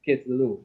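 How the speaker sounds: kit telu with the first syllable closed on kit. Shown as a demonstration. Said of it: "this is how you pronounce the word".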